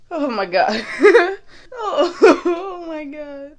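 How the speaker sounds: breaking into laughter; laughing. Real, not acted. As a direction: laugh through the words. Laughing fit.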